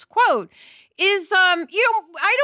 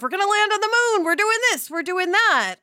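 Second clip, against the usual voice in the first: higher, fast and eager, like a breathless space-age promo; mock excited sixties optimism; 8 semitones higher than usual. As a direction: rattle it off like the whole country is giddy about the moon. Era's excitement about the moon.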